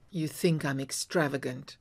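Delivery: usual speaking voice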